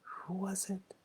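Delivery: breathy whispered question to yourself; inner whisper. Shown as a demonstration. Teacher whispering the mind's inner question. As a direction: ask it silently, barely breathed. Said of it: "it's about a type of".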